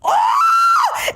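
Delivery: very high, loud shrieked "oh"; hammed-up squeal of disbelief; outraged shriek. Reliving the cashiers' question, outraged shriek.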